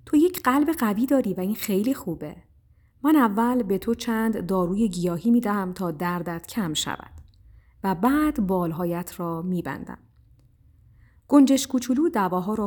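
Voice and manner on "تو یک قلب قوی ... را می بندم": usual speaking voice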